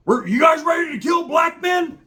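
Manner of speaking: high, loud rallying yell; imitated biker war cry; retold screaming. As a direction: scream it like you're rousing a gang. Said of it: "the whole time he's fucking screaming".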